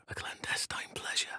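a secretive whisper; stealthy whisper. Acted. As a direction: keep it between us, barely breathed. Sneaking, secret remark.

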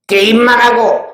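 put-on a sly little devil egging the kids on; taunting demon. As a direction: demon gleefully goading the audience. Headache demon tempts children.

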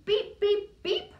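high loud toy-horn beeps; cheerful beep beep beep. Acted. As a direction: honk it out for the kids. Truck honks in picture book.

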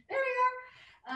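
high slow laughing. Real, not acted. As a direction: say it through a laugh.

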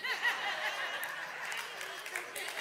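breathy high-pitched laugh; preacher cracking up at his own point. Real, not acted. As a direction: break into a squeaky laugh.

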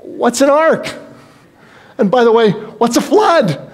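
bewildered man who's never heard of a flood; incredulous Noah. Retold or imitated. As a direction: Noah baffled by God's order, high and disbelieving. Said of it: "you gotta believe Noah must have said".

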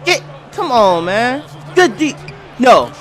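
usual speaking voice

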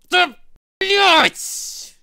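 loud angry curse; raging gamer. Real, not acted. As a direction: shout the curse in rage. Gamer loses, curses loudly.

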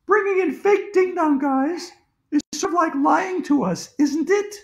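very high schoolgirl voice; accusing girl. A narrator's reading. Andrea accuses the principal of lying, high and reproachful. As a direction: press the point in a high little-girl pitch.

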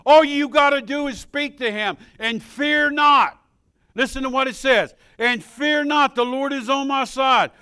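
loud, high, driving pulpit voice; preaching at full force. Acted. Preacher urges the congregation to fear not, loud and high. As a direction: fire the room up with it.

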